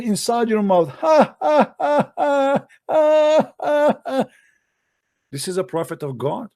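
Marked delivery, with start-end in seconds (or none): 0.9-4.2 high, spaced-out mocking ha-ha; exaggerated high-pitched fake laughter; mock devil's laugh. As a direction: laugh like a gleeful little devil. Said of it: "he will start laughing".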